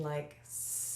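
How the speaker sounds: long hissing S through teeth; snake hiss. Shown as a demonstration. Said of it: "creating the S sound like a snake".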